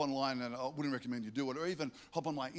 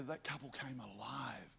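whispered and slow; hushed; 4 semitones lower, 11 dB quieter than usual. Real, not acted. Pastor telling the congregation, awed whisper. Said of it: "hushed emphasis in sermon".

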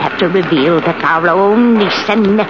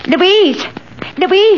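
calling out; 10 semitones higher than usual. Acted. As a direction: call her name from another room. Calling Louise from afar.

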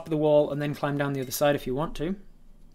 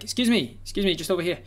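raised voice over crowd noise; calling out; 4 semitones higher, 3 dB louder than usual. Acted. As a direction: call out to get noticed. Trying to catch a guard's attention.

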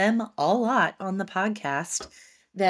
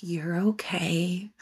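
husband soothing; much slower than usual. Retold or imitated. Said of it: "He was like".

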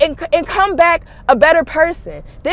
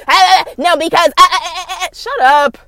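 mock flustered sputter; 3 semitones higher, 3 dB louder than usual. Mimicking someone defending themselves.

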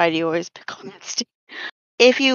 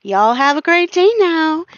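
put-on chirpy Southern server with a friendly accent; Southern politeness; 10 semitones higher, 5 dB louder than usual. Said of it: "they're like".

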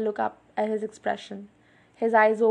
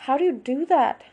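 higher, louder amazed question; amazed irritation; 4 semitones higher, 6 dB louder than usual. A narrator's reading. Bella asks Edward how he did that, amazed and irritated. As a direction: demand it, annoyed and astonished. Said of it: "I asked in amazed irritation".

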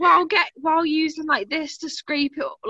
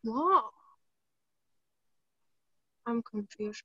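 ordinary delivery

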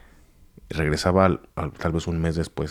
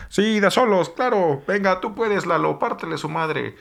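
egging on a fight; 11 semitones higher, slower than usual. Retold or imitated. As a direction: hype him up to throw the first punch.

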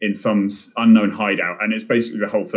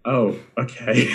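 resigned okay; 2 semitones higher, slower than usual. Retold or imitated. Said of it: "And he went".

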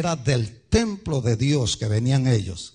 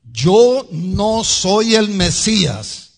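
loud, slow, high-pitched frank declaration; full frankness; 6 semitones higher, 8 dB louder, much slower than usual. Acted. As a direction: declare it firmly, word by word. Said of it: "Él dijo con toda franqueza".

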